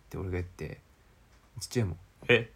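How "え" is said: sudden loud mock "huh?", lightly voiced as dad; botched father impression. Son mimicking his startled father, botched impression. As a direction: attempt dad's voice and let it slip out oddly. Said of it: "もう声真似しようとしたらすげえ声出ちゃった".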